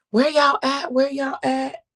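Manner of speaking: high loud call-out; loud fast street hustling. Real, not acted. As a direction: holler out to find the crowd. Calling out for viewers.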